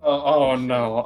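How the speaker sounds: usual speaking voice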